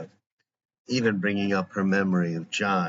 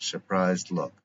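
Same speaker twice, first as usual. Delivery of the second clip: usual speaking voice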